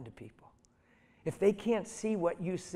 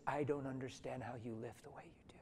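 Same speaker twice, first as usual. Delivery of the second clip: tentative, hushed, unvoiced whisper; timid supervisor whispering his confusion on the phone; mealy-mouthed whisper; 3 semitones lower, 11 dB quieter than usual. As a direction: whisper it hesitantly, unsure. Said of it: "in a mealy mouth voice and tentative".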